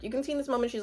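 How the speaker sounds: ordinary talk, nothing marked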